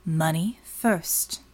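slow, low, measured demand; low, slow informant voice; curt demand. A narrator's reading. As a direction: set the terms, unhurried.

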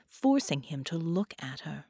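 usual speaking voice

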